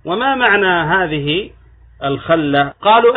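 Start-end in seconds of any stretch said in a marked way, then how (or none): 2.0-2.7 الخلة pronounced "khalla", with short a; fatha on the kha. Shown as a demonstration. Lecturer asks about opponents' reading, الخلة with fatha. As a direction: say الخلة as "al-KHAL-la", a after the kha. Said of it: "بفتح الخاء".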